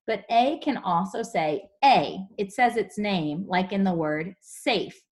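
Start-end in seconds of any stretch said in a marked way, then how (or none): none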